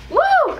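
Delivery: high, sharp woo of shock; high whoop. Real, not acted. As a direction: yelp it out. Reaction to sour taste.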